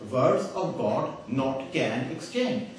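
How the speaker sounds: drawn-out word-by-word quote; slow deliberate misquote. Retold or imitated. Speaker reading from the man's books, very slow and deliberate. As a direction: read it out very slowly, word by word. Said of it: "It says in his books".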